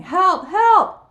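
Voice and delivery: high, loud yell; loud high holler; shouted cry for help. A narrator's reading.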